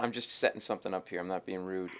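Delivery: usual speaking voice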